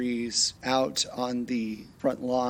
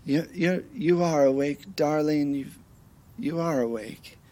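higher, tender worried husband voice; husband gently waking wife; 6 semitones higher than usual. Acted. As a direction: soothe her out of a nightmare. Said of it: "it's your husband in the bed".

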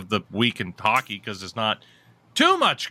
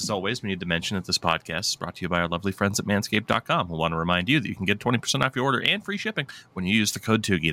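fast sponsor read; 5 semitones lower, faster than usual. Acted. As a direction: rattle off the ad quickly.

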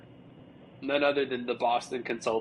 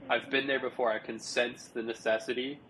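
ordinary talk

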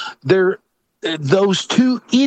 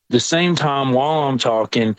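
ordinary delivery

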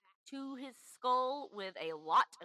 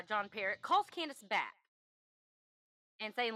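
usual speaking voice

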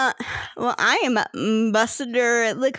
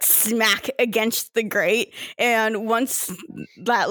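ordinary talk

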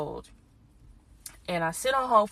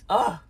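frustrated groan; 3 semitones higher, 6 dB louder than usual. Real, not acted.

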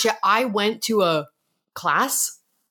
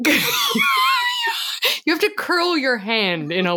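laughing; 10 semitones higher, slower than usual. Real, not acted. Laughing while explaining.

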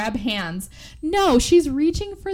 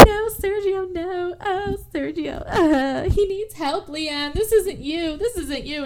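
high, sobbing plea; high tearful cry over a dying boyfriend; 8 semitones higher, slower than usual. Retold or imitated. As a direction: beg him through tears, pitched high. Character sobbing over dying man.

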